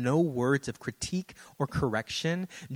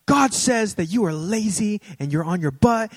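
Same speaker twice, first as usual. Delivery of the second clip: higher, louder scolding prophecy voice; mock accusing prophet; 7 semitones higher, 7 dB louder than usual. Lady prophesying over the pastor's friend, mock scolding. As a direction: scold him in God's name. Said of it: "It's like".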